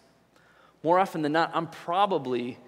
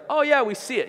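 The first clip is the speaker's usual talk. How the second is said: higher, louder breezy brush-off; dismissive crowd; 8 semitones higher, 6 dB louder than usual. Pretend. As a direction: wave it off without looking. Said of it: "without turning around, said, like".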